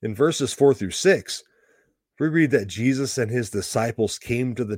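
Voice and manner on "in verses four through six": ordinary delivery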